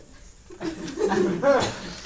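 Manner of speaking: high loud laugh. Real, not acted. Picturing mom looking over in the car, laughing. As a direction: burst out laughing.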